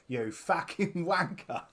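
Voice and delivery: slow, mock Cockney insult; milk the Cockney, drawing the words out. Henchman answering a filthy-immigrant insult, milked mock Cockney. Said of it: "he really milks his Cockney accent".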